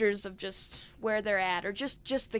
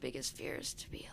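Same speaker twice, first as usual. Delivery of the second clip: breathy unvoiced whisper close to the mic; giggly prank whisper; 9 semitones lower, 11 dB quieter than usual. Real, not acted. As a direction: whisper it like a secret.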